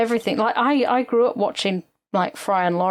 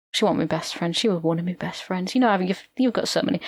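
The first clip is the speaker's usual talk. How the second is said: ordinary talk, nothing marked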